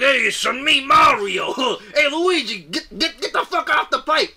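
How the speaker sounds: high, loud hammed-up Italian accent; old school Italian. Mario yelling at Luigi, hammed-up old school Italian. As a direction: shout it like a cartoon Italian plumber. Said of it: "talk like a real old school Italian".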